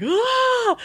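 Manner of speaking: a sudden high shriek of joy; excited shriek. Retold or imitated. Hearing she got the job, shrieking to her coworker. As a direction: squeal it out, thrilled. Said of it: "I'm like".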